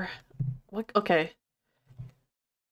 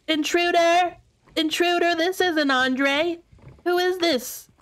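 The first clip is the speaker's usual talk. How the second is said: mock alarmed shout; 9 semitones higher, slower than usual. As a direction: raise the alarm, hammed-up. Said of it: "They're like".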